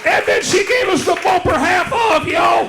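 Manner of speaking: comic yelling about the half-off bumper; excited shout. Real, not acted. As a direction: belt it out, still thrilled.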